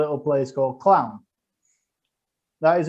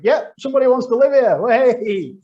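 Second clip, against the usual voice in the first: much louder, higher cheerful welcome; cheery mayor welcome; 9 semitones higher, 7 dB louder than usual. Retold or imitated. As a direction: greet him like a thrilled mayor. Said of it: "He just welcomed".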